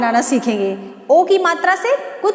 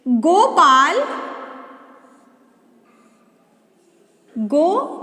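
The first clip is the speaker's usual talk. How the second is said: each word stretched with long gaps; slow dictation; 2 semitones lower, slower than usual. Shown as a demonstration. Teacher dictating a calling sentence to pupils, slowly. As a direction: spell the sentence out for little writers. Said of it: "dictating sentence".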